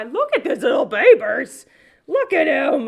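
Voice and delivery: loud, excited cooing over a plush; gushing cuteness. Real, not acted. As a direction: squeal over an adorable little thing.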